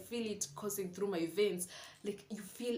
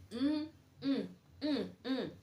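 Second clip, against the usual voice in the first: admiring hums; 6 semitones higher, slower than usual. Retold or imitated. Admiring herself in a dress.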